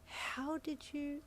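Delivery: high, very slow, soft amazement; stunned. A narrator's reading. Yin amazed at Ida's supplies, soft and stunned. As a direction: trail off in disbelief.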